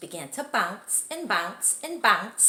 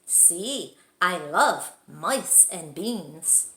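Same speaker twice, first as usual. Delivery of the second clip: slow reply; 2 semitones lower, 4 dB louder, much slower than usual. Acted.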